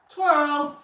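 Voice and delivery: high, loud one-word cue; dog command. Real, not acted. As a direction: call out the trick cue to your dog. Calling a trained cue to dog.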